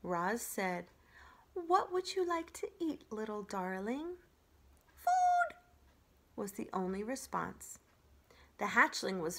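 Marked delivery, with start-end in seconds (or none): 1.5-4.2 robot trying to sound friendly; robot coaxing the gosling, gently friendly; friendliest voice. Acted. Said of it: "using her friendliest voice". 5.0-5.5 very high squeaky chick cries; tiny hatchling begging for food; hungry gosling peeps. Acted. Gosling answers.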